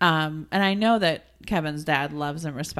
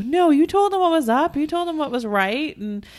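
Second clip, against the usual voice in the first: higher, earnest mock reassurance; a hint of a supportive husband; husband reassuring; 8 semitones higher than usual. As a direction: lightly voice the husband backing her up. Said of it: "He goes".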